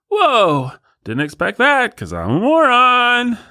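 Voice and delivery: high, dopey voice of an easily amazed idiot; dumb moron voice. Pretend. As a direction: play a moron marveling at obvious things, pitched high. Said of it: "the more moronic you are".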